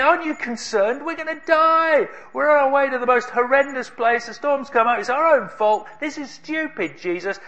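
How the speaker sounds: high, frantic pleading; scared and angry, voice up high; panicked complaint. Acted. Disciples in the storm panicking at sleeping Jesus, high and frantic.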